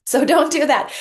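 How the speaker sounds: playful scolding; firm warning. Real, not acted. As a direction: shut that idea down, loud and firm. Stern joking warning.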